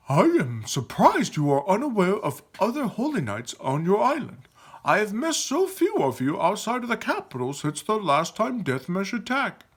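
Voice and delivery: high, formal character voice; grand knightly character. Acted. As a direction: pronounce it like a noble warrior. Character voice in dialogue.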